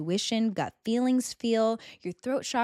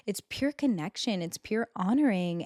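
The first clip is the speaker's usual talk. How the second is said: ordinary talk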